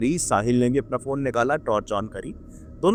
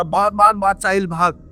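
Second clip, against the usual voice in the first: urgent shout, higher and louder; 5 semitones higher, 5 dB louder than usual. Retold or imitated. Ankur yelling at Sahil to flee, loud urgent shout. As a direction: yell at your friend to run. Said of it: "अंकुर चिल्ला रहा".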